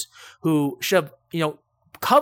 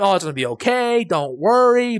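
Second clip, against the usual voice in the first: mock reassurance; 5 semitones higher, 5 dB louder than usual. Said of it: "We say that all the time".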